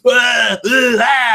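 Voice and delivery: high, loud hammed-up death groans; mock dying cries. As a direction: cry out as if playing a death scene. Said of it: "he pretends to die".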